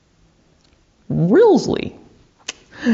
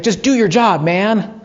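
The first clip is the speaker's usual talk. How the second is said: retold agitated demand, raised pitch; mildly worked-up, imitating a distraught man; 7 semitones higher than usual. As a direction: act out an agitated man challenging an officer. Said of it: "who's agitated looks at him and says".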